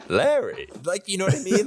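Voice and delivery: put-on high, loud old-movie accent; transatlantic accent. Shown as a demonstration. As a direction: perform the star voice on request. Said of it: "Say Larry".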